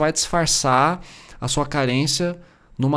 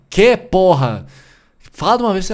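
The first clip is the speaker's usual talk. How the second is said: put-on mother finally losing her temper with the kid; exasperated mother; 6 semitones higher, 7 dB louder than usual. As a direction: bark it out, fed up. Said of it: "medo do jeito como minha mãe falava".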